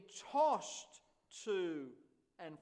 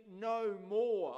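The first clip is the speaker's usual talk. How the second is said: "no more." said louder with more pressed down; stressed no more; 5 dB louder than usual. Shown as a demonstration. Preacher underlining the verse for his church, loud stressed more. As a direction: lean hard on more. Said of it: "I love that emphasis there".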